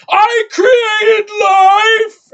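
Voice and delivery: hammed-up high scream, words stretched out; very slow, piercing villain shriek impression; Eddie Redmayne. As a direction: yell it out of nowhere, wildly overacted. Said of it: "And then he just yells out of nowhere".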